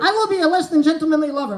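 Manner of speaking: nervous man tries to sound dominant, rushed and stilted; stilted fake dom. Awkward fake dominance, quick, loud and stiff. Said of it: "And I'm like".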